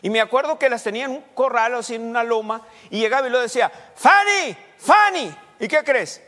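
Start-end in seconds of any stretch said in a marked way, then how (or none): 3.9-5.4 high, loud call across the hill. Retold or imitated. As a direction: shout the dog's name to bring her in. Grandfather calling the dog.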